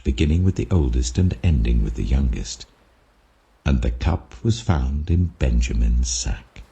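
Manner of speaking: ordinary delivery, nothing marked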